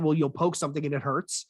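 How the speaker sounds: normal talking voice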